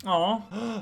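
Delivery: ordinary talk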